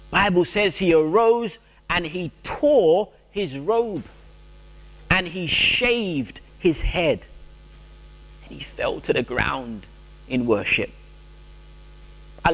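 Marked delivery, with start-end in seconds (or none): none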